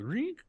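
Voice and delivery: sharp squeaky high-pitched blip; game alert sound. Retold or imitated. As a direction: mimic a video game alert noise. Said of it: "all of a sudden you go".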